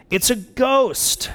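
high, stretched-out cry with little added volume; mild mock yell. A narrator's reading. Disciples see a figure walking on the water, mock yell. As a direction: draw out a half-yelled alarm. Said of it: "they yelled".

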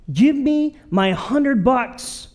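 mock menacing demand. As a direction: squeeze every word out of him. Servant choking debtor, demanding.

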